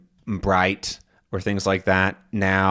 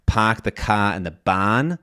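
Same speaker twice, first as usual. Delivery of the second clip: Boston "park the ka and the bon", fronted R-less ah; Boston accent. Shown as a demonstration. Teacher comparing regional accents, Boston accent. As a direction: say it Boston style, unchanging R-less vowels. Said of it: "talk about the Boston accent".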